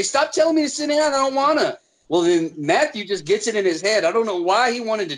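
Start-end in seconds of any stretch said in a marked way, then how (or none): 0.0-1.8 high-pitched mock tantrum; mimicked mad kid. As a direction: imitate a stubborn kid refusing. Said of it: "he'd get mad and say".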